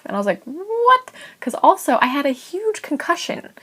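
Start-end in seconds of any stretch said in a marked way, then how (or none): 0.4-1.1 high-pitched drawn-out "what?"; retold shocked disbelief; incredulous. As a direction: react in stunned disbelief, voice shooting up. Retold reaction to being told to leave.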